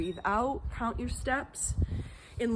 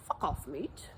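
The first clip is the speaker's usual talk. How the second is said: lower, softer British accent with a rising end; 6 semitones lower, 7 dB quieter than usual. Shown as a demonstration. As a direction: mutter it in a put-on British accent. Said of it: "in a British accent".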